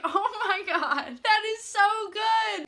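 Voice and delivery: high, drawn-out laughing exclamation; laughing delight. Real, not acted. Fan reacting to a movie insult, laughing in delight. Relives reaction to funny line.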